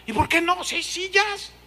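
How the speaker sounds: high indignant mock complaint; annoyed latecomer. Latecomer complaining to the usher, high indignant voice. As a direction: whine about the empty seats.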